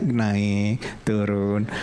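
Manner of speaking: drawn-out, slow words tracing the ride; slow demonstration. Shown as a demonstration. Preacher showing the audience how gently the ride moves, drawn out. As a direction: stretch each word out slowly.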